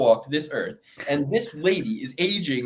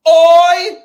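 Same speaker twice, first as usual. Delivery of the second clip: shouted Irish "Oi!", much higher and louder; 14 semitones higher, 15 dB louder than usual. Retold or imitated. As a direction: bark it like a cocky Irish fighter. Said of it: "and he's gonna go".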